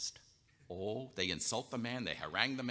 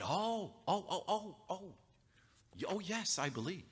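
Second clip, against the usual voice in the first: stunned realization; 8 semitones higher than usual. Acted. Man realises it's Jesus.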